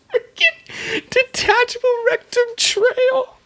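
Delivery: very high squeal through laughter; helpless real laughter, pitch shooting way up; laughing. Real, not acted. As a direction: squeeze the words out slowly while cracking up. Cracking up while repeating the name.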